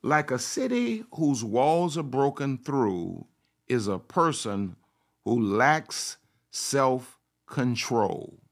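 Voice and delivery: usual speaking voice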